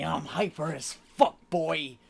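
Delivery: loud, pumped-up hype voice; hyped intro. Acted. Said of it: "Young God of Calamity".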